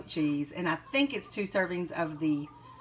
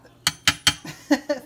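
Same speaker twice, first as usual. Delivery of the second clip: laugh; 9 semitones higher, 8 dB louder than usual. Real, not acted. Laughing at her own burnt pizza. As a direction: burst of real laughter.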